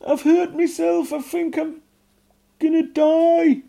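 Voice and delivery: very high, loud panicked goblin wail; goblin crying for mum. A narrator's reading. Hurt goblin calling his mum, high loud wail. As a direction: cry out that you're dying. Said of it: "he was crying".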